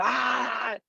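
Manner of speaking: a high mock battle cry; retold giant's yell. The brutish giant Bhima yelling as he runs through the mountains, mock yell. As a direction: bellow like a brute giant crashing through. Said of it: "Entonces iba gritando".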